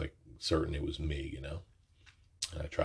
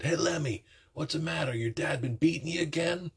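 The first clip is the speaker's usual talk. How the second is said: mocking taunt; 13 semitones higher than usual. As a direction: jeer at him in a high squeaky voice. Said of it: "And then they said".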